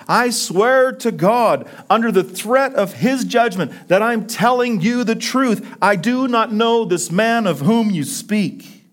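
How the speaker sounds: high-pitched, vehement oath; swearing an oath. Acted. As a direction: swear it emphatically before God. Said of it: "he's swearing to these guys before God".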